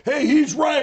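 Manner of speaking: high, loud crowd shout; loud defense. Retold or imitated. Man voicing Chicagoans who backed him, shouting in his defense. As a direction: call out like an indignant supporter.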